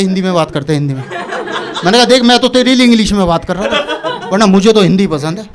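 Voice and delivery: ordinary talk, nothing marked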